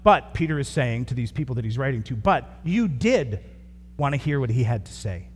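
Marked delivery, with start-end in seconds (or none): 2.2-5.3 "did" पर ज़ोर, धीमे और खींचकर; stress on did. Acted. Preacher stressing listeners' choice.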